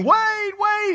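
very high cartoonish wail of someone falling; mock screaming. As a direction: plead in a hammed-up falling scream. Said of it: "as they're going down screaming".

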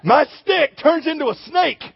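meek prophet boasting about his stick; mousy Moses. Retold or imitated. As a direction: squeak it like a nervous little guy. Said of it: "Moses says".

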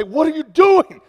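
high, loud exasperated plea; pleading shout. Retold or imitated. Pastor pleading with his mechanic, loud and high. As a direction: shake him awake with the words. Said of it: "grab him and say".

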